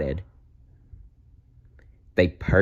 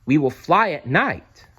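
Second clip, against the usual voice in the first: excited; 7 semitones higher than usual. Acted. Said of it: "Stella Luna said excitedly".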